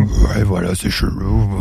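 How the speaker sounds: voix d'homme très grave, très basse; imitation de Brian. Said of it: "c'est toi qui l'as imité". (imitation de la grosse voix grave)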